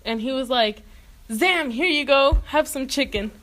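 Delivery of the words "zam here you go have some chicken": high, loud conjuring exclamation; magical flourish. Acted. Rasputin conjuring chicken for a woman, magical flourish. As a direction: present it with a showy burst.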